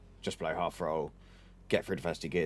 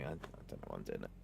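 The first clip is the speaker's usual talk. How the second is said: embarrassed mumble; 13 semitones lower, 15 dB quieter, much faster than usual. Real, not acted. Embarrassed aside after car noise.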